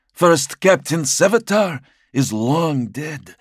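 high, loud, slow report; high servant voice. Acted. Helath bows low.

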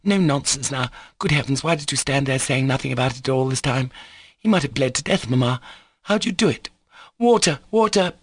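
put-on bossy, alarmed girl taking charge; imperious cry. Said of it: "she cried imperiously".

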